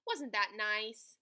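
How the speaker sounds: ordinary delivery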